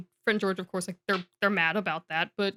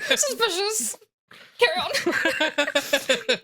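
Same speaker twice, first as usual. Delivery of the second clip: higher, very slow drawn-out "Carry on."; dramatic suspicious official; 7 semitones higher, much slower than usual. Shown as a demonstration. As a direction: narrow your eyes and stretch "Carry on." out slowly. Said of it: "that's a joke no one else will get".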